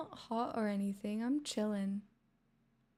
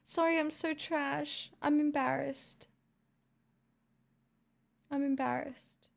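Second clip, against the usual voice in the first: whiny apology; 5 semitones higher than usual. Real, not acted. Self-deprecating whiny apology.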